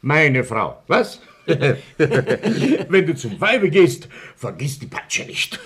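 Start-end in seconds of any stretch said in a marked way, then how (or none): 1.5-1.9 laughing. Acted. Husband laughs at his wife. 2.0-5.6 laughing. Acted. Quotes Nietzsche while laughing.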